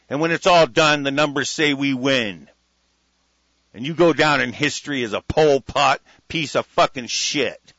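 loud, forceful on-air tirade; angry defiant rant. Real, not acted. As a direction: hammer out each threat at the enemy. Defiant political rant addressed to enemies.

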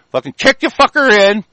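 ordinary delivery

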